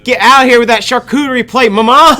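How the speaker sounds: high, loud spoiled brat shouting; bratty kid yelling. Pretend. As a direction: scream at Mama like a spoiled gamer. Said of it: "New character, Rich Gamer Team".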